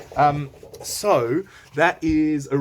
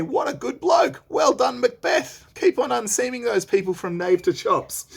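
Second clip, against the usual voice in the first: high, loud cheery king; hearty royal pat on the back; Duncan paraphrased; 10 semitones higher, 4 dB louder than usual. Acted. Teacher paraphrasing Duncan cheering Macbeth on, high and loud. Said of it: "As in to say".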